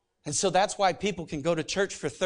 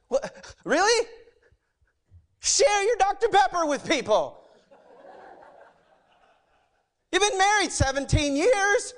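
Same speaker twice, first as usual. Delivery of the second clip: high, loud mock exasperation; exasperated God; 5 dB louder than usual. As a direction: scold in disbelief as an exasperated God. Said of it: "And God's like".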